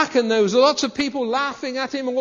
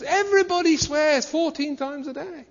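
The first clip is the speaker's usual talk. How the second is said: mocking; 6 semitones higher than usual. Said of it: "they say these stupid things".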